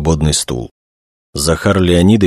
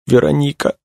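high, moaned name; moaning; 9 semitones higher than usual. A narrator's reading. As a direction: moan it out in grief, pitched well up. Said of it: "простонала молодая женщина".

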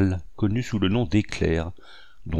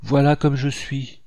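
sadly; 6 semitones higher, 3 dB louder than usual. A narrator's reading. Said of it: "en disant tristement".

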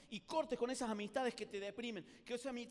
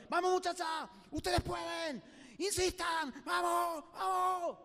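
high, urgent rallying shout; shouted cheering; 7 semitones higher, 4 dB louder, much slower than usual. Acted. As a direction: cheer them on from far above. Frogs above cheer trapped ones. (gritos de aliento)

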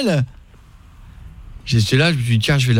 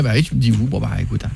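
usual speaking voice

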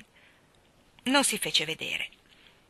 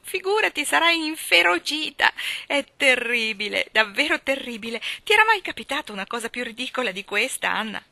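loud, high, tumbling out in a rush of excitement; breathless excitement; 4 semitones higher, 8 dB louder than usual. Acted. Girl tells her friend about the fright, loudly excited. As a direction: blurt out the news of the scare. Diana bursts in after fright.

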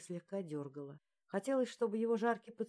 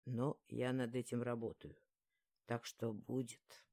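low, husky, slowing at the end; hoarse male voice; 6 semitones lower, 3 dB quieter than usual. A narrator's reading. He says he is working on a home, low hoarse voice. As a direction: rasp it low and unhurried. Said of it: "прохрипел он".

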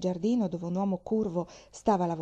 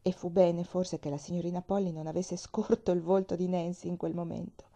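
plain reading voice

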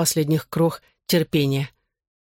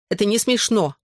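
higher, mildly mock-tearful protest; fake sob; 5 semitones higher than usual. A narrator's reading. Girl protests at his laughter, fake-sobbing. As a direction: protest with put-on hurt, pitched up. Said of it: "фальшиво всхлипнув".

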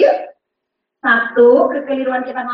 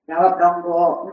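normal talking voice

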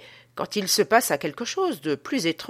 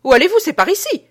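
high, loud call from the grille; strange calling voice; 11 semitones higher, 11 dB louder, faster than usual. A narrator's reading. Man at the cellar grille calls the visitors back, high and loud. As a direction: call up the stairs in an eerie voice. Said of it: "avec la voix étrange".

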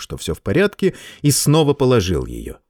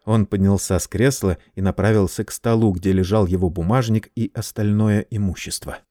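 ordinary delivery

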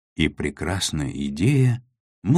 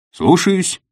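loud gung-ho soldier; 8 dB louder than usual. A narrator's reading. Soldier-like acknowledgment, very high.

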